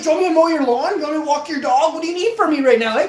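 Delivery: high, loud, gushing offers; overeager gratitude. Retold or imitated. Kid offering chores to his rescuer, gushing eagerness. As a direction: offer favors in an excited rush.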